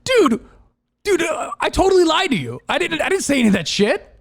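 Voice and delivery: gleeful shouted prank reveal; gleeful confession. Retold or imitated. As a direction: crow it like a prank just landed. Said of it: "They're like".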